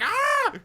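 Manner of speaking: enemy screaming when smacked from nowhere; mock terrified scream. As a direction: shriek as if jumped out of nowhere. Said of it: "They're like".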